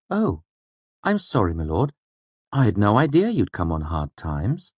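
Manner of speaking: high, exaggerated mock concern. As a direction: fake pity, oozing sweetness. Mask of concern.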